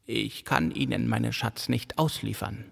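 soft androgynous old man, lightly voiced; an old man speaking softly from the shadows; an aged stranger, soft and sexless in tone. A narrator's reading. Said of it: "Seine Tonlage klang androgyn und weich, aber auch alt".

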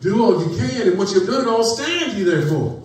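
preaching climax. Acted.

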